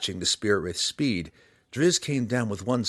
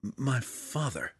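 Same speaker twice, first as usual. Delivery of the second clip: much softer, stammering start; choked up; 3 semitones higher, 7 dB quieter than usual. A narrator's reading. Drizzt finishing the thought to Belwar, choked and hushed. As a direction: drop the volume and nearly choke on it. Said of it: "volume dropped considerably ... choked on his words".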